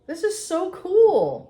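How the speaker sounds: excited. Real, not acted. Excited first sniff of cookies.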